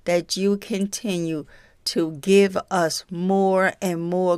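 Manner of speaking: soft, low earnest prayer. Real, not acted. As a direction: pray quietly and humbly. Closing prayer.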